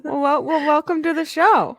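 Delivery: bright show-host welcome. Pretend. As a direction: greet like a game show host.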